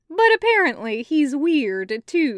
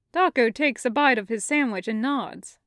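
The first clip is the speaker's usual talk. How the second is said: usual speaking voice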